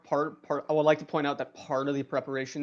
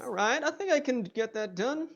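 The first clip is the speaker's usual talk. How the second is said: high-pitched lizard man; 9 semitones higher than usual. Acted. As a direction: agree in the lizard man's high voice. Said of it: "the lizard man will notice you".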